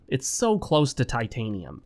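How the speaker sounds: ordinary talk, nothing marked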